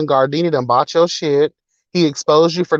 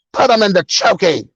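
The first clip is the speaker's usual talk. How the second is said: booming mock headmistress, pitched up; Trunchbull impression; 5 semitones higher, 3 dB louder than usual. Mocking a scammer with a Matilda headmistress voice. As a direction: ham up a tyrant headmistress barking orders. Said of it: "We gonna Matilda your ass".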